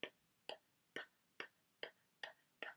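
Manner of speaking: soft unvoiced puffs of p; blowing p. Shown as a demonstration. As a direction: blow out candles with whispered p. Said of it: "I went p".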